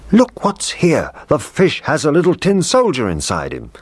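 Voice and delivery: high, excited cook's voice; a cook crying out in surprise; surprised cook. Acted. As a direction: cook amazed at what he finds. Cook finds soldier in fish.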